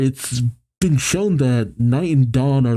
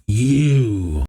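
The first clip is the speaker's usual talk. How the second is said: calling out. A narrator's reading. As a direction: call out the one word, held long. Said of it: "as Jet calls out".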